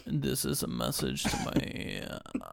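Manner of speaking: low, slow sultry whisper; imitating a husky seductive voice; sexy voice. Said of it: "trying to put on the sexy voice".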